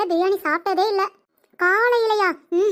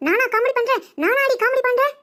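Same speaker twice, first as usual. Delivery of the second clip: indignant; 5 semitones higher than usual. Acted. Mother-in-law indignant, repeating question.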